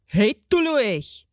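slow, grumpy gnome in dialect; cranky dwarf's drawn-out call; bawling garden gnome. A narrator's reading. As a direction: bawl in character without real volume. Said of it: "bölkte ein Gartenzwerg".

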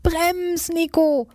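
high alarmed yell; mother shouting at her son to brake, high alarmed yell; mother's shout. A narrator's reading. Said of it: "schreit Mama".

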